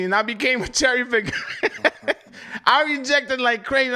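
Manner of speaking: ordinary talk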